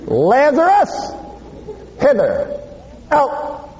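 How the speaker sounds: Jesus summoning Lazarus from the tomb; commanding call. Acted. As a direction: call the dead man out with authority.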